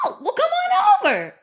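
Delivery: very high laughing come-on-over; high laughing invitation to friends; high breezy invite. Retold or imitated. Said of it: "And it's like".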